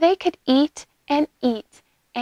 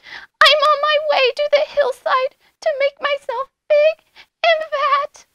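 tiny, high-pitched little goat; very small goat voice; 4 dB louder than usual. A narrator's reading. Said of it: "in his very small voice".

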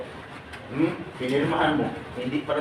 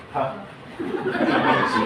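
drawn-out mock disbelief; much slower than usual. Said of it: "Sabi ng iba dyan".

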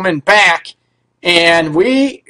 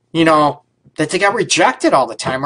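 ordinary delivery

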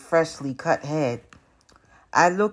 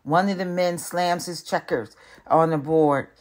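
ordinary delivery, nothing marked

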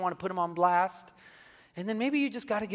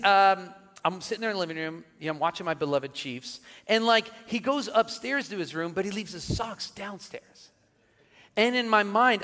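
ordinary delivery

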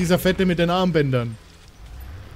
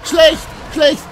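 high, loud yells of alarm; panicked screaming; 12 semitones higher, 8 dB louder than usual. Real, not acted. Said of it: "Hat man an deinen männlichen Schreien gehört". (panisches Schreien)

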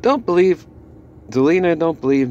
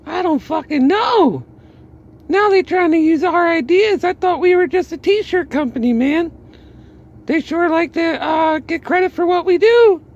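much higher, louder mocking voice; imitating Tony Khan; 4 dB louder than usual. Vlogger imitating Tony Khan, high and loud mocking. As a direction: squeak it out as a baffled, annoyed boss. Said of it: "scratching his head like".

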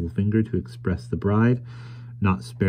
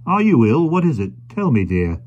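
alarmed questioning; 6 semitones higher, 5 dB louder than usual. A narrator's reading. Carrier begging his wife to answer, alarmed concern. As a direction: plead anxiously to know what is wrong. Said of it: "exclaimed the carrier".

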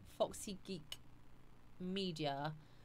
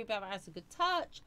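usual speaking voice

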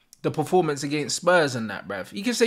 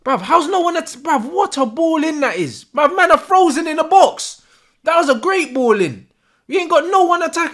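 higher, louder exasperated complaint; frustrated shouting; 9 semitones higher, 6 dB louder than usual. Real, not acted. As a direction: yell at the screen about no one attacking. Frustrated at empty box.

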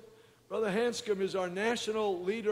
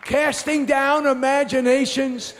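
shouted sermon, loud and high, hammering each line; full-force preaching; 4 semitones higher, 10 dB louder than usual. Acted. Preacher shouting to his congregation about why people came. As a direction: preach it hard to the back row.

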